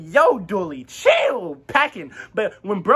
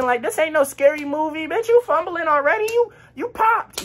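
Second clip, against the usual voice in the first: high-pitched, rising mock rant; incredulous scolding; 7 semitones higher than usual. Berating the panicking boy who set them up, incredulous scolding. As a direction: climb higher as the scolding builds.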